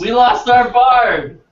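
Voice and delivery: high, loud, stretched-out cry to the group; mock alarm. Player announcing the lost bard to fellow players, hammed-up alarm. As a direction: call it out in playful despair.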